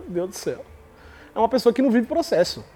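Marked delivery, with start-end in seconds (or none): 0.0-0.6 exasperated mutter. Retold or imitated. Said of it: "A pessoa olha pra sua cara e fala".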